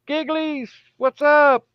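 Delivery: put-on laughing while calling Gigglies in baby talk; cutesy baby talk. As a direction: coo at the pet like a baby. Calling cutely to a pet.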